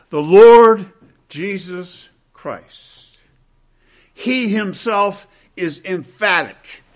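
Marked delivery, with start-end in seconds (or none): 0.1-0.8 THE LORD called out much louder; proclaimed loud. Real, not acted. Preacher calls out the Lord's name to his congregation, loud. 1.3-3.2 Jesus Christ drawn out slowly, dropping softer. Real, not acted. Preacher lingers on the name before the church, slow and soft. Emphatic pause after the Lord.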